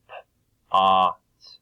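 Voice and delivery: "P, A, T" sounded out for pot; segmented sounds. Shown as a demonstration. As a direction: separate "P", then loud "A" into "T". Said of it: "take apart the sounds".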